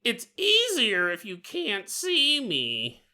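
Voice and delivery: high, slow creepy coaxing; drawn-out, high-pitched creepy reassurance; Big Bird. Acted. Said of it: "Big Bird throws a blanket over your head".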